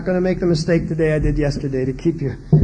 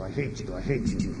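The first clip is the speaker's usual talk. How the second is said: soft, low, repeated under the breath; sulking kid grumbling alone in his room; muttered resentment; 9 semitones lower, 12 dB quieter than usual. Retold or imitated. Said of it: "They're saying".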